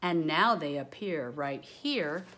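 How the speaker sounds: put-on lively rhyming read-aloud for kids; bouncy picture-book voice; reading to children. A narrator's reading. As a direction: read it like a storybook to a class.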